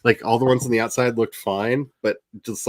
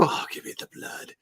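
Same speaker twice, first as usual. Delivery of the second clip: breathy creepy whisper; a little monster cat begging; whispered vampire cat; 14 dB quieter than usual. Retold or imitated. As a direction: whisper it, hungry for blood. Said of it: "the other two are just like".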